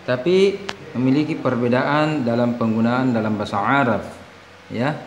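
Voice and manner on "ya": normal talking voice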